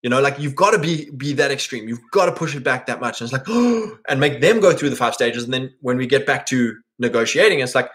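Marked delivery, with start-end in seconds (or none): 3.5-4.0 high startled gasp; shocked gasp. Retold or imitated. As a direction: gasp in mock horror. Mock shocked reaction.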